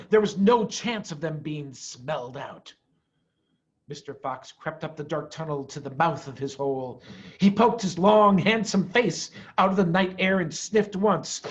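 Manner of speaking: usual speaking voice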